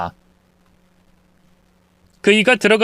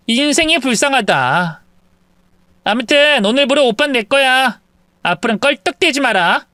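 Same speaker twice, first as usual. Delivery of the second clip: put-on sneering, condescending young woman; mocking mistress; 7 semitones higher than usual. Mistress mocking wife's background, sneering. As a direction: look down on her with a sneer.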